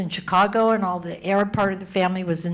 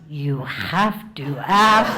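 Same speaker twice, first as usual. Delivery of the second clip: put-on slow, drawn-out old aunt voice; aunt's slow comeback; 4 semitones lower, 3 dB louder, much slower than usual. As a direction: drag it out, wounded. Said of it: "Here we go again".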